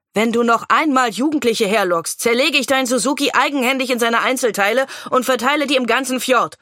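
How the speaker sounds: a hard warning, no backing down; threatening. A narrator's reading. Policewoman warning the old man about luring teenagers: sharp threat. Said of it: "drohte sie".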